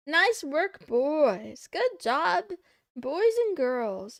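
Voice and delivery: loud, hearty cheer for the viewers; loud praise. Acted. Streamer cheering on chat for good work, loud and hearty. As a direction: cheer the chat on like a proud coach.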